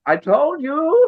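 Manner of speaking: high, slow, gloating sing-song; high drawn-out I told you. Retold or imitated. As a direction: drag it out smugly.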